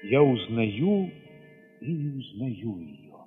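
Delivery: normal talking voice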